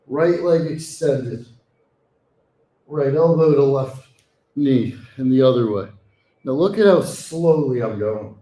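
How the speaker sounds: ordinary delivery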